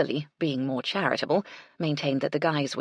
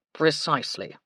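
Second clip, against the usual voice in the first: stiff butler. A narrator's reading. Baxter's voice.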